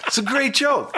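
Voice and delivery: can barely finish the line for laughing. Real, not acted. Host laughing at his own long joke, still cracking up.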